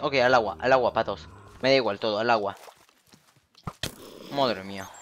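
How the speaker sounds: ordinary delivery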